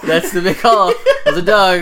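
loud, high, through real laughter; amused, laughing admission. Real, not acted. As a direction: crack up while agreeing. Laughing along at the joke.

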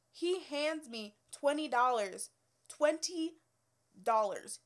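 dwelling on each word in disbelief; amazed emphasis. Real, not acted. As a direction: spell out the shock of the amount. Disbelief at a big tip.

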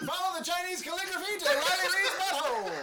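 race caller. Pretend. Mock race call.

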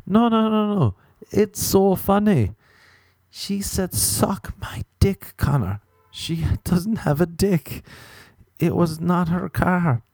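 high, slow, put-on friend voice; hammed-up insistence, words stretched out; insistent explaining friend. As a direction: mimic a friend labouring the joke. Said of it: "kept laughing, and he kept explaining".

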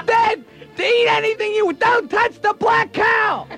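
high-pitched tattling yell; mock kid's yell. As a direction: yell for Dad like an outraged kid. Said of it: "Then you yell".

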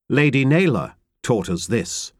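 ordinary delivery, nothing marked

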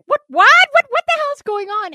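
high, loud, stammering what-what-what; shocked disbelief. Retold or imitated. Her own reaction to her partner's surprise, acted-out shock. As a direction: sputter like you've been caught off guard. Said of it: "And I'm like".

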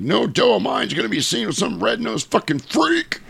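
high-pitched mock outrage; yelling dad. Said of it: "dad shows up and yells".

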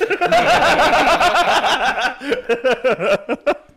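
long, high, loud real laugh. Real, not acted. Cracking up at the dog-paw joke, a long loud laugh.

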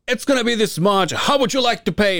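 high showman cashier voice; announcer voice. Retold or imitated. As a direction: read the price like an announcer.

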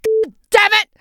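retold furious shout from the back, high and loud; angry director yell. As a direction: bellow it at the actors like a fed-up director. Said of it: "suddenly you hear this voice and saying".

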